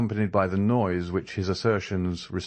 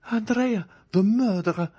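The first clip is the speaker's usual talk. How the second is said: much higher, alarmed accusing cry; frightened cry; 4 dB louder than usual. A narrator's reading. A lady recognises the intruder and cries out in fear. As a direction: cry out the name in terror. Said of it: "cried one of the ladies".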